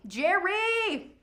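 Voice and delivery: high, drawn-out name call; excited hail. Real, not acted. Auctioneer hails a new bidder, excited.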